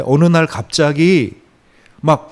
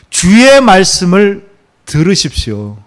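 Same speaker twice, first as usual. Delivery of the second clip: slow, much louder and higher proclamation; emphatic declaration; 5 semitones higher, 9 dB louder, slower than usual. Real, not acted. Preacher answering his own question to the church, slow loud proclamation. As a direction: proclaim it slowly with full force. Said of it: "exhorting the congregation".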